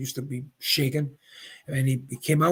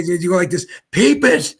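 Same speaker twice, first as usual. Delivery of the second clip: loud playful quote; 4 semitones higher, 7 dB louder than usual. Retold or imitated. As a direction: Peep it called out high and loud. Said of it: "and he'd say".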